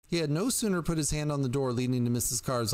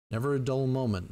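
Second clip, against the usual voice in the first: plain reading voice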